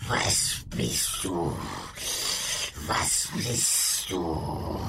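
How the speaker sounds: slow, eerie creature voice; monster voice. Acted. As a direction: drag it out like a beast.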